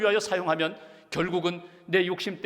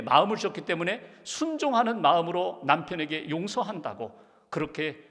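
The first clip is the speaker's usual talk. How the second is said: ordinary talk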